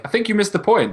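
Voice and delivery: mock commenter. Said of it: "It's like".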